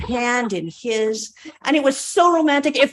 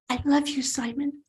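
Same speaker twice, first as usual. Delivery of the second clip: softer, tender and hushed; soft confession; 3 semitones higher, 8 dB quieter than usual. Acted. Margaret confessing love to sleeping Simon, soft. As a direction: let it fall to a hush.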